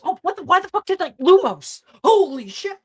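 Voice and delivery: high, loud, fast panic; frantic shriek as the acted racer; panicked outburst. Racer scrambles to light the dark tunnel, panicked high yelling.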